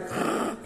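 short, soft imitated gasp; mock gasp. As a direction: gasp as if at a heaped plate. Imitates seeing overloaded plates.

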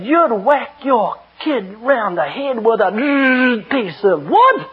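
slow, dragged-out outraged voice; mimicking the announcer's horrified outrage; indignant accusation. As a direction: spell it out in scandalised disbelief. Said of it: "Like that".

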